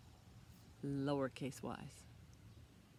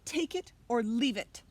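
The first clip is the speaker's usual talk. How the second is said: louder, ultimatum bark; angry archduke; 6 semitones higher, 9 dB louder than usual. Acted. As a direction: demand it impatiently. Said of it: "shouted the archduke".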